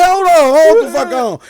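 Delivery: heated protest. Retold or imitated. Retelling own protest.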